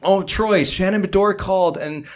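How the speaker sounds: high, lightly voiced manager; a light take on the flustered manager; quoting the manager. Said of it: "the manager runs over and he's like".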